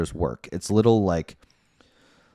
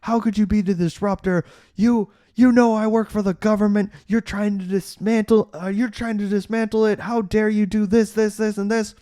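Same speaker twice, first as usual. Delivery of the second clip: much higher, louder indignant father; mock shout at the son; hammed-up furious dad; angry yelling; 12 semitones higher, 3 dB louder than usual. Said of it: "immediately starts yelling at Dave".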